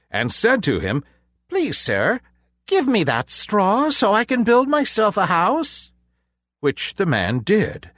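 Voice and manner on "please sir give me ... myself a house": high, bright piglet voice; a small polite pig asking; little pig voice. A narrator's reading. Pig asks man for straw.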